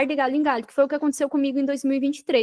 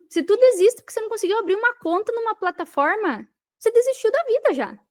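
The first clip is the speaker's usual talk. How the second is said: higher and incredulous, scolding the quitters; you can't believe anyone would give up over that; exasperated disbelief; 8 semitones higher than usual. Real, not acted. Guest scolding beginners who quit too easily, exasperated disbelief.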